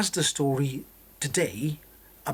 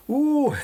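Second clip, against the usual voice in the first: amused high ooh; 10 semitones higher, 6 dB louder than usual. Real, not acted. Laughing at own Welsh joke.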